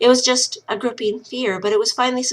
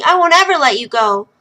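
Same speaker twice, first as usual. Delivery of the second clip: louder and higher, leaning on ever; fierce vow; 5 semitones higher, 7 dB louder than usual. Acted. Lil fiercely vows to her brother never to let go. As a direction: promise it with force.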